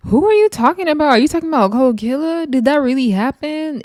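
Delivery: high-pitched, incredulous questioning; shocked disbelief. Acted. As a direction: question it as if appalled. Teller acts shock at shocking remark.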